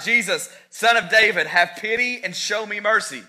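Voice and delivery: loud, high cry for mercy; a beggar shouting for Jesus to notice him; blind beggar's shout. A narrator's reading. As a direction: call out to Jesus over the crowd. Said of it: "The blind beggar shouted".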